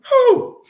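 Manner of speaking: shrill-free high exhale "whew"; high startled whew. Real, not acted.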